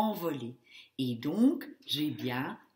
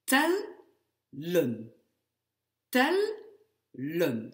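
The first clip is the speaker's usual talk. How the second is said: tellen broken as tel, pause, len; split into syllables; 3 dB louder, much slower than usual. Shown as a demonstration. Teacher cutting the word for students, tellen in two separate syllables. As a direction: stress tel, then drop to len after a gap. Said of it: "Ce seront donc bien les deux syllabes".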